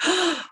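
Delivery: sharp, high shocked gasp; sudden acted "oh" of dismay; crowd gasp. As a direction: imitate a crowd gasping in shock. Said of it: "and they gasp".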